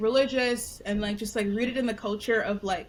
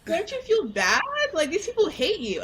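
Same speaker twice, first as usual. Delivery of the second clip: indignant parent; 8 semitones higher than usual. Retold or imitated. Parents' indignant scolding, acted out.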